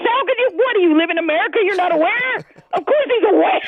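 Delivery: high, incredulous outburst; worked-up, high-pitched indignation; indignant disbelief. Real, not acted. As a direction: fire back rhetorical questions in exasperation. Caller indignantly answering host's question.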